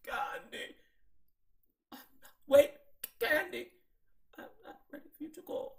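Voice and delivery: high urgent mock [name], ending in a laughing whisper; imitating [name] again. [name] begging Kandi to stay, high laughing mock voice. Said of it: "She didn't say it quite like that".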